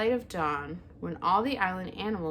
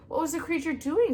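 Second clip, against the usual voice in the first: high, louder, anxious weasel; 10 semitones higher, 6 dB louder than usual. A narrator's reading. Weasel asking the owl, higher voice. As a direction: squeak it out higher. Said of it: "said Dart the weasel".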